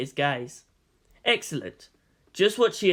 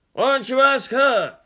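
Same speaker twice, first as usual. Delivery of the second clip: put-on higher, louder sly old shopkeeper; 8 semitones higher, 6 dB louder than usual. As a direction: tease him with a knowing question. Owner points to the woman.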